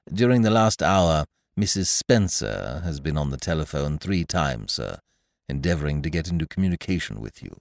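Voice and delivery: calm, deferential butler reply; Jeeves. A narrator's reading. Valet calmly advising his master to leave London. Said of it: "Jeeves reporting aunt's calls".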